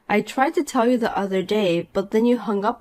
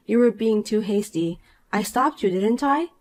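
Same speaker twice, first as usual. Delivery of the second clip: ordinary talk, nothing marked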